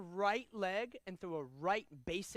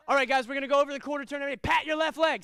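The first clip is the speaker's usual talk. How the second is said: loud, fast and higher, bursting with energy; energetic teaching voice; 5 semitones higher, 12 dB louder, much faster than usual. Shown as a demonstration. Instructor demoing an enthusiastic class opener, loud and fast. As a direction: pump up a room full of kids. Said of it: "Everybody watch me".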